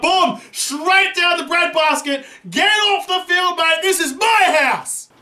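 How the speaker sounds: high, slow gloating taunt at the batsman; mock trash talk. Gamer taunts the virtual batsman, gloating. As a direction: crow over the wicket like you own the pitch. Trash-talking after taking a wicket.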